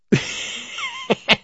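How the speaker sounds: real delighted laugh at the prank; high burst of laughter. Real, not acted. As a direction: let a high giggle escape. Laughing at the reveal.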